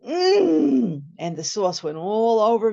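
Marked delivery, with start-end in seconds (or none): 0.1-1.0 savoring mm. Retold or imitated. Tasting the sauce.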